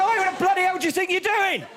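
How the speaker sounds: high, loud outraged shout; angry yell. Retold or imitated. Paul in his tent to the stamper, high angry yell.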